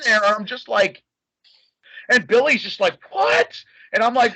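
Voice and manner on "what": high startled what; a sharp double-take what. Retold or imitated.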